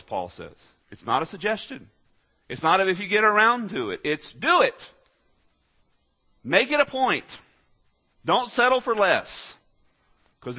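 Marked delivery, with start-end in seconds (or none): 1.1-9.6 forceful preaching. Real, not acted. Preacher driving home commandment.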